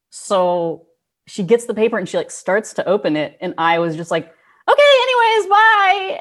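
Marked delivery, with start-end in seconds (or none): 4.7-6.2 high, loud, rushed brush-off; squeaky panicked exit. Retold or imitated. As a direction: chirp it out high and flee. Awkward exit after slipping number.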